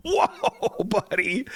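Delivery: laughing mock shock. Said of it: "And I'm like".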